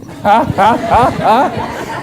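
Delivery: high rising burst of laughter; real high laugh; delighted laugh. Real, not acted. Laughs at audience mantra.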